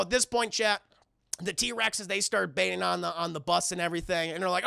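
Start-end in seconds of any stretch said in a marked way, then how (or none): none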